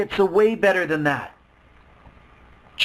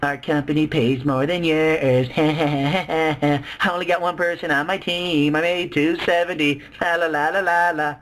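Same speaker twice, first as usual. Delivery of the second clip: slow, lower sing-song taunt; gloating la la la in a lower voice; mock gloat; 4 semitones lower than usual. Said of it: "mocking the doubters".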